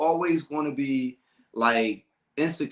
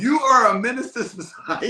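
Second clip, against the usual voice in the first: higher, louder, laughing through it; 6 semitones higher, 5 dB louder than usual. Real, not acted. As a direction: tease while cracking up. Laughing at friend's story.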